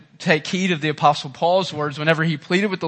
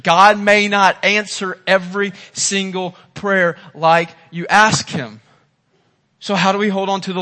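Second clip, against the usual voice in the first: rising preaching; 2 semitones higher, 5 dB louder than usual. Acted. Preaching builds loud.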